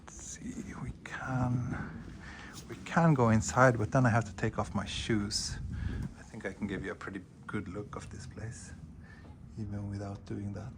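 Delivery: hushed unvoiced whisper. Real, not acted. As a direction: whisper so as not to disturb. Quietly indoors near house.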